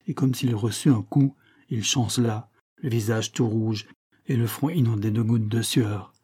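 plain reading voice

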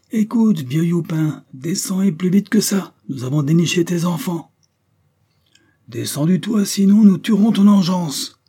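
high, loud mob howling threats; menacing high-pitched crowd yell; yelling at the top of one's lungs. A narrator's reading. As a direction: holler it across a courtyard. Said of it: "on hurlait à tue-tête".

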